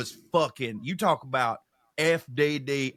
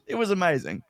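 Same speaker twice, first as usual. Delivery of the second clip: ordinary talk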